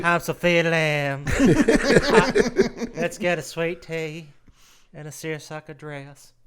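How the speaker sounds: slow, laughing southern drawl; hammed-up southern belle; southern belle accent. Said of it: "slight southern accent".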